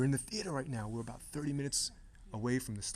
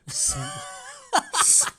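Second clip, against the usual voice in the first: laughing through a word slip; 8 dB louder than usual. Real, not acted. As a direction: giggle out the correction slowly. Laughs at his own slip.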